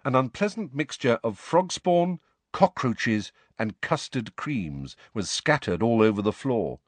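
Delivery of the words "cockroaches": ordinary delivery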